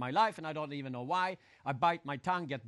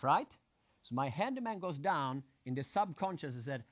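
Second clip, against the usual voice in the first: ordinary talk, nothing marked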